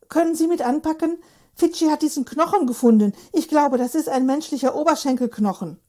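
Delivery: man asking a policeman for help, urgent; urgent request. Acted. Hubert urges the policeman.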